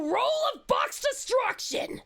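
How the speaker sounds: slow, dramatic shouted attack name; hammed-up battle cry. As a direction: yell it like an anime special move.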